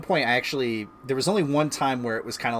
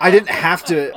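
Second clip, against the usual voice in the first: laughing; 6 semitones higher, 10 dB louder than usual. Real, not acted. Retelling quitting, laughs.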